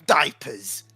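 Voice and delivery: put-on low, soft, patronizing old man; low condescending scold. Player character to the guard captain, low patronizing scold. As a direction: talk down to him like a child. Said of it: "I'm gonna call him boy".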